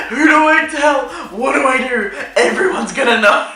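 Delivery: high and loud, laughing through each question; half-laughing mock panic. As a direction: panic and giggle at once. Panicked quote while laughing.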